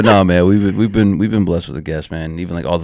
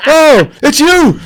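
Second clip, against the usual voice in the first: high, loud startled yell; startled shout; 10 dB louder, much slower than usual. Retold or imitated. Javier startled in his chair, shrieking high and loud. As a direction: jump out of your skin and shout. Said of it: "jumps out the fucking chair".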